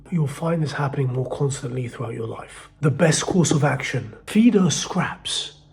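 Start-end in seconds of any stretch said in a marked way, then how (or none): 4.3-5.6 slow, drawn-out advice; slow emphasis. Real, not acted. Telling the guys the best move, slow and emphatic. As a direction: spell out each word slowly so it sinks in.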